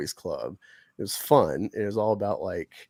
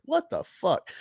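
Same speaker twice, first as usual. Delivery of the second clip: high acted shock. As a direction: blurt it out in disbelief. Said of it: "That's why I'm like".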